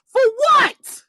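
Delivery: a sharp, high, falling "what" in "For what?"; high exasperated for what. Real, not acted. Ranting at followers, high exasperated "For what?". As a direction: fling "For what?" up high in disbelief.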